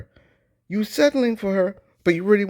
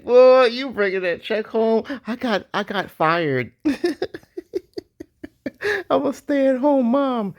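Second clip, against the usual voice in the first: slow, higher, sassy wife voice; imitating wife; 6 semitones higher than usual. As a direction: drawl it out like a wife brushing off work. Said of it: "she was like".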